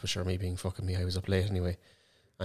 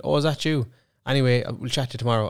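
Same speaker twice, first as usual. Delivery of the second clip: higher, bright fake-breezy hello; fake casual greeting; 5 semitones higher, 4 dB louder than usual. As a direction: play it cool, pitch up to cover the panic. Feigning casual greeting to roommate.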